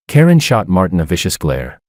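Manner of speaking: usual speaking voice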